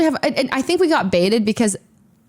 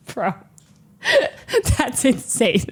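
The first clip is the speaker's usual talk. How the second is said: laughing disbelief; 3 dB quieter, slower than usual. Retold or imitated. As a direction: laugh at how absurd. Disbelief at casual family.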